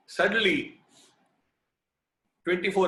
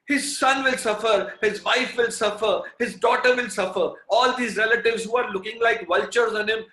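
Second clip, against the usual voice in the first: tearful fervent prayer; 6 semitones higher, 6 dB louder than usual. Retold or imitated. Devotee begs Swami to save his dying friend, high, loud, tearful pleading. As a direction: beg with everything, near tears. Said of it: "I prayed with all my heart, with tears welling up".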